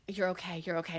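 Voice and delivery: calm someone down tenderly; reassuring. Retold or imitated. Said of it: "He is reassuring himself".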